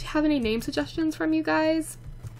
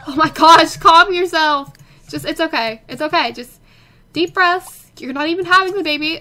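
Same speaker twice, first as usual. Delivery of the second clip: loud, higher, urgent reassurance; loud calming; 3 semitones higher, 10 dB louder than usual. Real, not acted. Telling the screaming sim to breathe, loud. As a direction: shout calm at a panicking sim.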